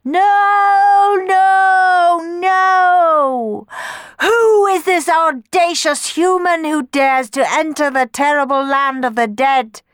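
the Furies scream at the intruding mortal; high, loud shrieking challenge. A narrator's reading. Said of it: "screamed the Furies".

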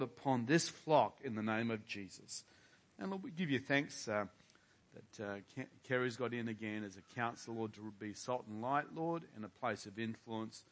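usual speaking voice

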